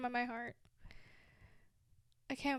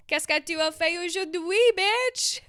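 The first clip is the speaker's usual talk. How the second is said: high, loud playful French with a sassy bite; cheeky French tease; mock French sass; 7 semitones higher, 11 dB louder than usual. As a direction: toss out the French line like a cheeky show-off. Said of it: "I know a few sentences".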